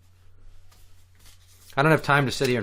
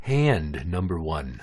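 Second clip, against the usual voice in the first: announcer voice; 7 semitones lower, slower than usual. Acted. Low slow game announcement.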